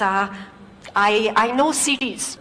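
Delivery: put-on slow, halting Korean accent; a confused guest answering in a lightly put-on Korean accent; hint of Korean accent. Korean engineer misunderstands.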